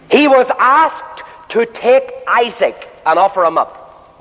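each word slow and deliberate; slow it right down so it sinks in; measured, unhurried preaching; said very slowly. Shown as a demonstration. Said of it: "let me say it very slowly".

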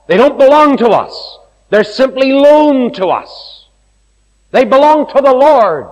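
loud, high preaching climax; preaching at full force. Acted. Preacher to congregation, rising loud and high. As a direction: drive the point home to the congregation.

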